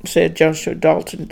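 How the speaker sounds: usual speaking voice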